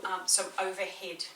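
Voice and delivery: ordinary talk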